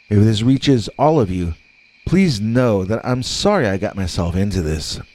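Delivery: ordinary delivery, nothing marked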